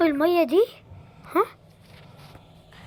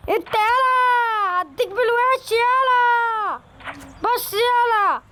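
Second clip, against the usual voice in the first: calling out; 7 semitones higher, slower than usual. Real, not acted. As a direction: shout for his attention. Calling out to someone.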